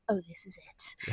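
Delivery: hushed excited whisper. Retold or imitated. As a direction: whisper it to yourself. Whispered thought during trip.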